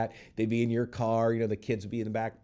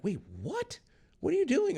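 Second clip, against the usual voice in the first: high squeaky disbelief, pitch shooting up on the question; mock baffled. Agents reacting to his dog question, in high incredulous disbelief. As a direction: play the agents thrown completely off balance.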